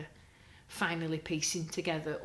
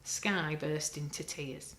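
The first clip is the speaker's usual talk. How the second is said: ordinary delivery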